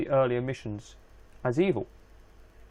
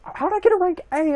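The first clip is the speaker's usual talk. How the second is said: surprised; 6 dB louder than usual. Real, not acted. Gamer shocked by unexpected rank.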